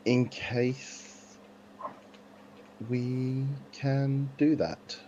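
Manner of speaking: normal talking voice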